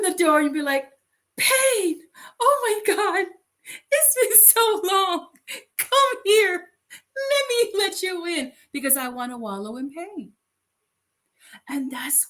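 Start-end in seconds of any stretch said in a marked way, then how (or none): 1.4-10.3 overjoyed reunion act, voice pitched up; mock delighted greeting. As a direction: greet a long-lost friend, hammed-up. Acts greeting Pain like old friend.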